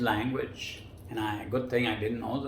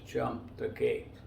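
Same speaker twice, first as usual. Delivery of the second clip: low, slow repeated command; slow, deep voice in the head; insistent inner voice; 13 semitones lower, 4 dB quieter, slower than usual. Retold or imitated. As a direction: deliver the order slowly, word by word, pitched down.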